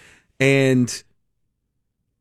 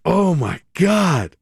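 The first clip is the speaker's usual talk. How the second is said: amazed; 5 semitones higher, much slower than usual. Retold or imitated. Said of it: "I was like".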